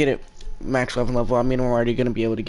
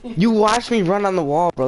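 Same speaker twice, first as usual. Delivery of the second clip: outraged; 8 semitones higher, 5 dB louder than usual. Real, not acted. Outraged at the game.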